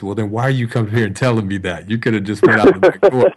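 mock exasperated muttering growing loud; exasperated thought. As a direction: voice the inner scoff at the kid. Inner exasperated reaction to kid.

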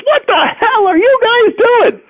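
imitating an outraged older sister; angry older sister. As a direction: bust in as the furious big sister. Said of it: "pounds down the door and says".

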